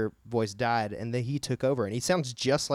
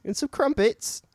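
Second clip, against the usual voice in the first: put-on high, loud caricature of a British accent; hammed-up British tea-time voice; mock British accent; 10 semitones higher, 7 dB louder than usual. Joins in the botched accent attempts.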